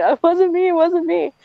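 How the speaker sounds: high, squealed denial at talking volume; mock childhood scream. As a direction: imitate a kid screaming innocence, pitched right up. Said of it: "I just remember screaming".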